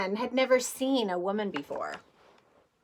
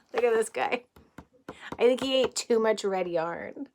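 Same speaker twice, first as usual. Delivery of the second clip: ordinary delivery, nothing marked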